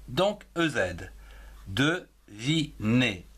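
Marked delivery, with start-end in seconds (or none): 1.7-3.2 Devinez stretched slowly in three syllables, de-vi-nez. Shown as a demonstration. Teacher sounding out Devinez in three syllables. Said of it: "En trois syllabes".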